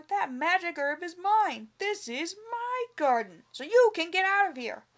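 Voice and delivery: loud, high angry yell. A narrator's reading. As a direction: yell it in his face. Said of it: "yelled the farmer".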